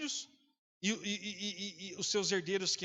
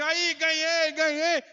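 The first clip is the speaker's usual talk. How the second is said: loud, higher, delighted boasting; of joy; 7 semitones higher, 8 dB louder, much slower than usual. Retold or imitated. Pastor shows off his mother-in-law's gift, loud joy. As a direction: show off the gift with glee. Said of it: "de alegria".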